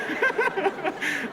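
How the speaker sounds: loud high-pitched laugh. Real, not acted. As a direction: burst out laughing at your own story. Laughing at her own story.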